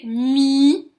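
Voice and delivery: "mi" with a long, loud i; sounding out the syllable. Shown as a demonstration. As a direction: stretch "mi" so the final i rings out. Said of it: "on entend le i".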